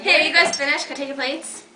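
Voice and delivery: bright high server voice; chirpy waitress checking the table; waiter voice. Acted. Waiter role again.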